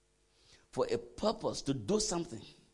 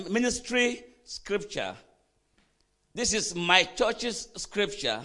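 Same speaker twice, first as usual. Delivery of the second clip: raised, high preacher's voice; emphatic preaching; 7 semitones higher, 7 dB louder than usual. Real, not acted. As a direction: drive the point home to the congregation. Preaching at full force.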